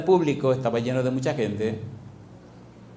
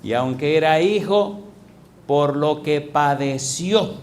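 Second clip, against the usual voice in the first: loud, driving sermon voice, stretching the quoted verse; preaching at full force; 3 semitones higher, 5 dB louder than usual. Acted. Pastor reading Hebreos to the congregation, loud fervent preaching. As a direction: hammer the verse home to the congregation.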